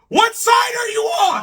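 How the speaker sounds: high, loud disbelieving cry; shocked shout. Real, not acted. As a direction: yell it in stunned disbelief. Shocked outburst at guest.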